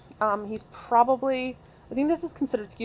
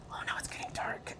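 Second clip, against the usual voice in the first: hushed, whispered dismay; soft, voiceless oh-no; worried whisper; 11 dB quieter than usual. Real, not acted. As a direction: whisper it to yourself as danger creeps in. Realizes night is falling in game.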